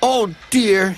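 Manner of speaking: high-pitched woe; dismayed lament. A narrator's reading. Stuck engine to himself, high dismayed lament.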